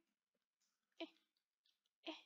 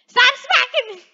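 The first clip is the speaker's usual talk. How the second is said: high, loud mock complaint; protesting yell; 7 semitones higher, 10 dB louder than usual. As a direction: yell at her to quit it. Protests being hit in game.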